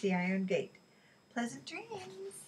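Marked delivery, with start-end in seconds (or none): 1.3-2.4 much higher, softer, slow farewell; sinister farewell. A narrator's reading. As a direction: smile through a sly goodbye. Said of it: "He smiled broadly".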